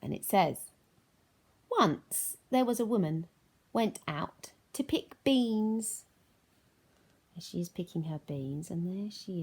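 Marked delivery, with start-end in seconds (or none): 1.7-6.0 slow, higher read-aloud narration; storytelling read. A narrator's reading. Teacher reading to children, slow storybook voice. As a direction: read it like a bedtime tale.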